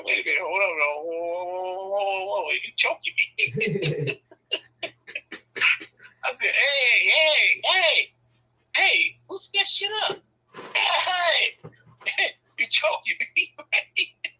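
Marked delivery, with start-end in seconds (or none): 0.0-4.2 mock choked alarm. Husband pinned in wife's leg lock, mock choked pleading. 6.5-14.3 high, loud, stretched-out heys; mock panicked yell. Husband choking in wife's headlock, high mock panicked yell. Retold being choked, yelling to wife.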